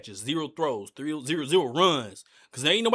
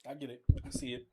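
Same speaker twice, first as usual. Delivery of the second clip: ordinary talk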